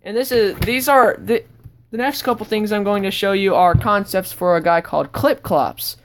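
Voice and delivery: usual speaking voice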